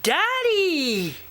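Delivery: high cry of "Daddy"; boy seeing his dad squashed; alarmed call. Acted. Luke calls to flattened dad.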